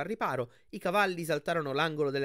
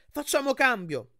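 high, loud call over the din; loud, stretched, urgent cry; urgent shout; 12 semitones higher, 7 dB louder than usual. Acted. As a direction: shout a quick plan to a teammate, pitched way up. Urgent proposal mid chariot race.

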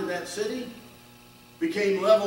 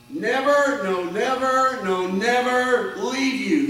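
"never" cried out higher and louder each time, slowing on "no, never leave you"; emphatic never; 6 semitones higher, 5 dB louder, slower than usual. Shown as a demonstration. Preacher giving the original Greek to the congregation, emphatic and loud. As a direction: hammer home each "never". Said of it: "The emphasis is on".

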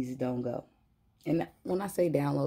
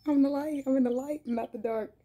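delighted squeal; 12 semitones higher than usual. Real, not acted. Reader thrilled by her light, squealing with laughter.

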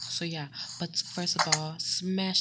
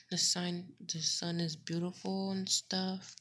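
usual speaking voice

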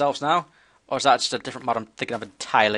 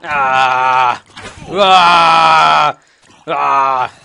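battle yells; 12 dB louder, much slower than usual. Real, not acted. Fighting off attackers.

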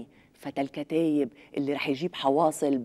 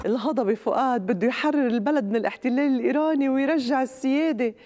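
mock grandiose announcement; 9 semitones higher, 3 dB louder than usual. Mock announcer over music.

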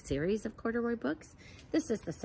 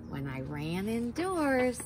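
bright, higher storybook reading; reading to children; 5 semitones higher than usual. A narrator's reading. Teacher reads a rainbow book to kids, lively. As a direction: read it like a picture book to little ones.

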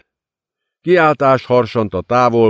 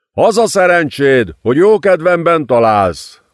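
louder, higher savage shout; angry yell; 7 semitones higher, 4 dB louder than usual. A narrator's reading. Mark kicking Tess, yelling in fury. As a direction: roar it down at her. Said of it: "ordította a férfi".

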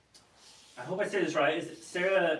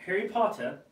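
usual speaking voice

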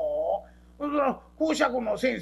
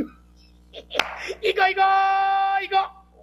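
drawn-out loud shout, higher; big shout; 9 semitones higher, 7 dB louder, much slower than usual. Acted. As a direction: bellow it slowly and eagerly. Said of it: "ほら、大きな声やな". (大声でゆっくり叫ぶ)

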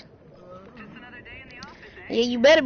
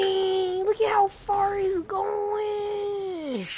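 high, drawn-out amazement; amazed; 10 semitones higher, 4 dB quieter, much slower than usual. Real, not acted. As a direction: stretch it out in disbelief. Amazed slow high exclaim.

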